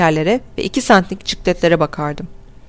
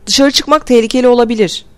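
higher-pitched, mildly shouted warning; 5 semitones higher, 4 dB louder than usual. A narrator's reading. Norton warning everyone not to go out, moderate shout. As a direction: warn a crowd with a raised voice. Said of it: "diye bağırdı".

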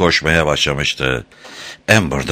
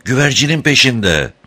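higher-pitched warning call; shout; 6 semitones higher than usual. A narrator's reading. Safran warning that the crocodile follows, shouting. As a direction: call out the danger. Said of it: "Bu kez bağıran Safran'dı".

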